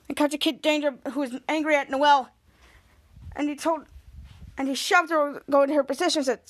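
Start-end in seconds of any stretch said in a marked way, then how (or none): none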